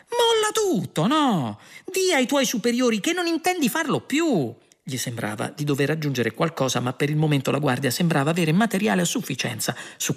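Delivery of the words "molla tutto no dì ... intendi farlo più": high, eager urging; lively. A narrator's reading. Ford incalza la guardia, vivace e acuto. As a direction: pitch it up, bright and quick. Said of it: "accennò Ford con vivacità".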